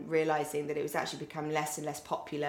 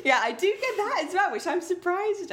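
laughing; 4 dB louder, slower than usual. Real, not acted. Laughing at South African joke.

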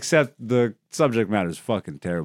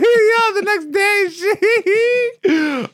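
laughing; 4 dB louder, much slower than usual. Real, not acted. Laughing at the memory.

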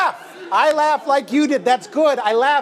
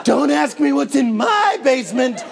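higher, hammed-up creep voice; creepy stranger; 4 semitones higher than usual. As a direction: play a menacing weirdo. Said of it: "not a creepy smile".

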